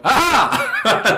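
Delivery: high laughing aha; a sudden penny-drop laugh; realization. Retold or imitated. A sailor realizing he was fooled, laughing aha. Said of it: "And I thought".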